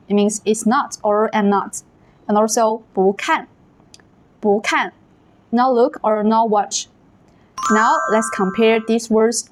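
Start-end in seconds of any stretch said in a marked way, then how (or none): 2.9-4.9 不看 with 不 rising in second tone; bú kàn, rising then falling. Shown as a demonstration. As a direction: lift 不 before the falling 看. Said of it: "hear the same change in these examples".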